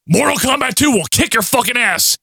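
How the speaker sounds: high-pitched hype plug; a hint of over-the-top game commercial; mock ad read. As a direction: sell it like a hyped-up sponsor.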